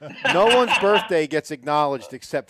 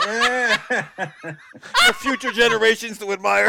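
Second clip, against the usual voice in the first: laughing; 7 semitones higher, 4 dB louder, much slower than usual. Real, not acted. Joking, slowed and laughing.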